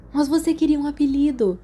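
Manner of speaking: offended. A narrator's reading. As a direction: sound wounded by the rebuke. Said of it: "parecendo ofendido". (protesto ofendido)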